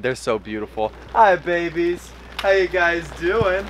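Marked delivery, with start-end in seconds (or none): 1.1-2.1 raised sing-song pet voice. Real, not acted. Owner greeting his fish, higher baby talk. 2.4-3.6 slow, higher cooing question; drawn-out coo to the fish. Real, not acted. Owner cooing slowly to his pond fish.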